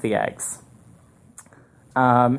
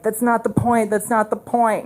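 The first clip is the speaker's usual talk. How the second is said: put-on much higher, insistent kid's protest; 9 semitones higher than usual. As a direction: replay yourself pleading over the egg fiasco. Kid arguing with student teacher.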